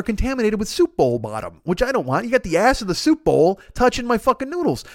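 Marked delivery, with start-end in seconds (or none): none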